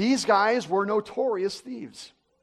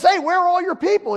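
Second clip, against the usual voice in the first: surprised call; 10 semitones higher, 4 dB louder than usual. Retold or imitated. Pilot calls out on landing.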